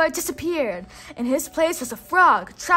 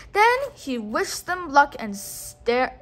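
usual speaking voice

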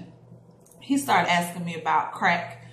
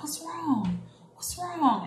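soft, high-pitched worried asking; retold tender concern; imitated concern; 6 semitones higher, 5 dB quieter, slower than usual. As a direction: gently check on a crying child, acted lightly. Older girl comforting crying child.

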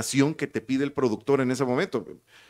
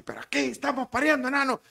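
high, fast mock yell; retold yell; 12 semitones higher, faster than usual. Producer retold complaining to the announcers, high mock yell. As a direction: cry it out high without real volume. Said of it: "En un buen grito".

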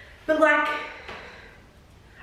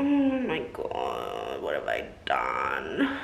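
much lower, slow, quieter hammed-up dread; mock doom; 6 dB quieter, slower than usual. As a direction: play it like a doomed villain, deep and slow. Melodramatic regret after trying on.

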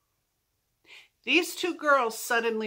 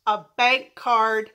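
normal talking voice

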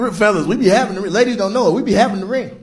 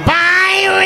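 calling out; 12 semitones higher than usual. Acted. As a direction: yell the name like a movie scene. Calling out the name, movie reference.

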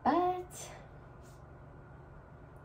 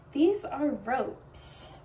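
slow deadpan; 3 semitones higher, much slower than usual. Real, not acted. Displays thin ropes deadpan.

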